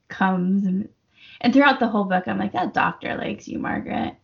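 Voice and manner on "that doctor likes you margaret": whisper. Retold or imitated. Reader voicing thoughts to character.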